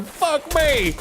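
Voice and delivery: high, far-off drill sergeant yell. Retold or imitated. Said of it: "he's going".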